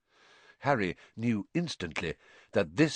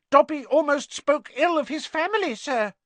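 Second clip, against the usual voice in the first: high, loud house elf confessing; high-pitched creature; 5 dB louder than usual. A narrator's reading. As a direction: confess in an agitated squeak. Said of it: "in a high-pitched voice".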